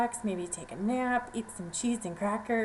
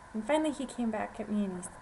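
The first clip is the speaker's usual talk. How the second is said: ordinary delivery, nothing marked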